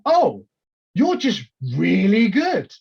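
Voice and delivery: mock stunned compliment; admiring surprise. To the wrestler after the match, amazed praise. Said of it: "I was like".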